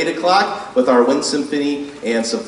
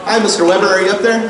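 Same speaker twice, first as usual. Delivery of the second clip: raised voice calling upward; shouting up to balcony; 7 semitones higher, 4 dB louder, faster than usual. Real, not acted. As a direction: call up to someone far above. Calling up to photographer above.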